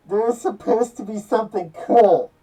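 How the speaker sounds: high, loud cry of frustration; angry outburst. Real, not acted. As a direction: yell it out, let down and mad. Still raging about the failed ending.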